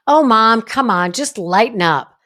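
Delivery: put-on loud, high, brushing-off teenager; whiny teen. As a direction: roll your eyes and wave Mom off. Mother voicing her dismissive teen.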